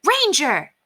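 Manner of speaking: sharp, high-pitched call to a dog. A narrator's reading. Woman calls her Dalmatian to heel, sharply. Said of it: "The woman spoke sharply, too".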